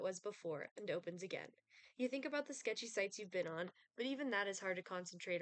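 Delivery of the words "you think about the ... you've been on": ordinary talk, nothing marked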